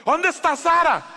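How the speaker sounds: put-on high, loud call for a lost child; a father's alarmed demand, pitched high; alarmed shout. Father reenacts losing his daughter. (grito alarmado)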